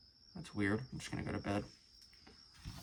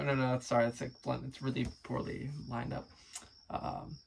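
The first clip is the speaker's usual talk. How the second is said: ordinary delivery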